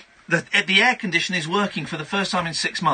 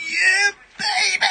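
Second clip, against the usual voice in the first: high, drawn-out hammy shout; mock catchphrase shout; 13 semitones higher, slower than usual. Host bursting out to the studio with a hammy shout. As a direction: belt it like a cheesy catchphrase.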